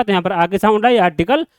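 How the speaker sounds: ordinary delivery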